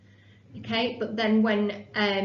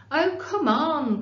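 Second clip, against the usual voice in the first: cried out; 6 semitones higher, 5 dB louder, much slower than usual. Acted. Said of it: "cried Josie".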